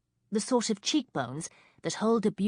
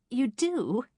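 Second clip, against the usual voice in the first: put-on girlish; 8 semitones higher than usual. A narrator's reading. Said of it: "Kate laughed girlishly".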